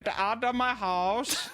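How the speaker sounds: slow mock Dutch host voice; mock Dutch accent. Host ordering guests out, put-on Dutch accent. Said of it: "Dutch host voice".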